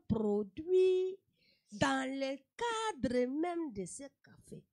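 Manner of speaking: hammed-up slow Cameroonian formality; Cameroonian accent. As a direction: stretch every word like a grand speech. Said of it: "très longues phrases, mais pour rien du tout".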